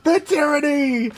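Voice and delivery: high, drawn-out theatrical wail; hammed-up high adoring cry; mock dramatic wail. Shown as a demonstration. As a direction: camp it up, high and slow like a swooning fan. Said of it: "if I do a sick play".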